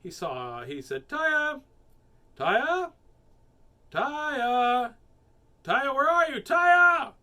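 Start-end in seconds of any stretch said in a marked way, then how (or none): 1.1-7.1 high, loud searching shout; calling out. A narrator's reading. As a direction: call out for a lost child. Father calling for lost daughter.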